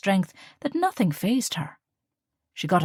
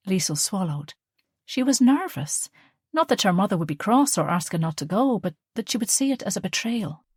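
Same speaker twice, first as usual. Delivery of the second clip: plain reading voice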